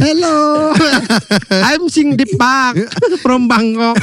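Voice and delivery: put-on Thai accent. Mocking Thai fan.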